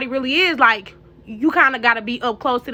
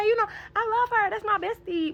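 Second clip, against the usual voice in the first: tearful affection; 8 semitones higher, 7 dB quieter than usual. Real, not acted. Emotional about loving mama.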